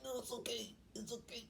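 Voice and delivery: high, soft pained voice; small, high, quiet voice; strained reassurance. Retold or imitated. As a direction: squeak out reassurance through mock pain. Mother in labor, straining, retold.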